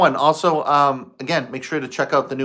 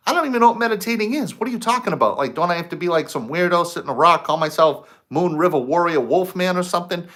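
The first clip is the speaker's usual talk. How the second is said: much higher, fast, disbelieving; incredulous inner rant; 4 semitones higher, faster than usual. Retold or imitated. Man argues back at the voice, incredulous and fast. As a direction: scoff at the idea in a rush. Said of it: "thinking to myself".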